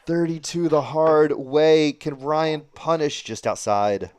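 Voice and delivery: loud, high, slow call of the score; excited call. Real, not acted. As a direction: sell the moment like a TV caller. Live call of a checkout.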